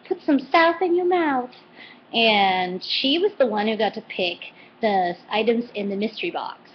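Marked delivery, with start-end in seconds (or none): 0.1-1.5 mock Southern accent. Vlogger imitating Paula's Southern accent. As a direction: imitate a Southern cook's catchphrase. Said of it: "She was like".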